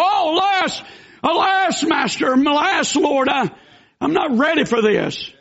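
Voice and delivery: high alarmed wail, then calming; panicked outcry. Acted. Believer hit by sudden trouble, high frightened cry easing. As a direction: cry out in fear, easing off at the end.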